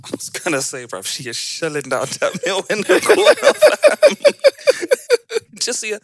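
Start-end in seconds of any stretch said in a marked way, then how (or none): 1.9-4.2 laughing. Real, not acted. Laughing at dog. 4.2-5.6 shrieking laugh, very high; high squealing laugh. Real, not acted. Big laugh at dog.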